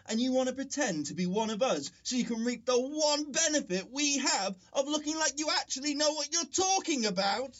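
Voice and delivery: normal talking voice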